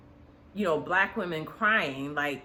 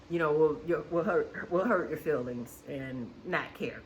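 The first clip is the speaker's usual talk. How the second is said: ordinary delivery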